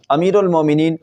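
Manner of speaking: usual speaking voice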